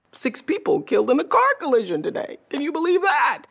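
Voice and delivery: high, startled news-read line; mock surprised newsreader. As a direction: act shocked at your own headline.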